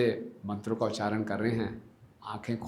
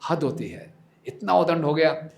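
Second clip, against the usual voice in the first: higher, rising to a louder outburst; exasperated anger; 5 semitones higher, 6 dB louder than usual. Acted. Fed-up father vows to punish Nimai, exasperated anger. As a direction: fume at the boy. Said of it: "इतने परेशान हो गए".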